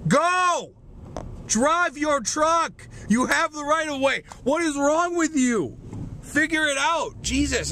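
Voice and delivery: high-pitched, exasperated yelling at a driver; exasperated road rage. Real, not acted. Driver yelling at a truck that won't go, exasperated. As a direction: lose patience, pitch jumping up.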